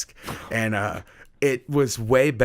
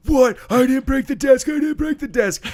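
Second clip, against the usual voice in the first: panicked, much higher and louder denial; freaked out; 7 dB louder than usual. Retold or imitated. Seventh grader denying he broke the desk, panicked high yell. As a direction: shriek it like a cornered kid.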